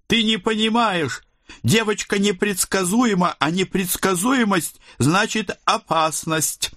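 very high-pitched female character voice; warning committee woman. A narrator's reading. Committee woman warns the officer the girl is dangerous, very high-pitched warning. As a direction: pitch it far up as the committee lady.

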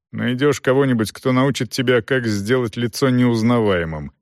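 usual speaking voice

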